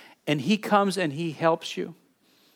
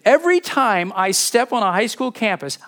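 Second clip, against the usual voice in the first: louder and higher, pressing it home; emphatic retelling; 6 semitones higher, 5 dB louder than usual. Retold or imitated. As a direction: make them picture the campus. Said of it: "Picture this".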